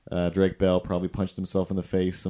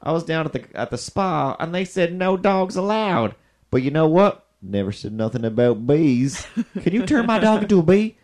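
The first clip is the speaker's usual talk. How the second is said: higher, louder put-on voice, building to an excited ask; hammed-up customer; 9 semitones higher, 5 dB louder than usual. Customer begging the groomer for a bee dog, in a hammed-up excited voice. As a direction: sell the bee idea with giddy energy. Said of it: "the next lady comes in and she's like".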